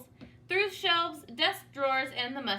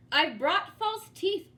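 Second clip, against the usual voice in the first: called out, higher and louder; calling out; 7 semitones higher, 5 dB louder than usual. Acted. Dentist calling to George through the door, loud and high. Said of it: "the dentist called".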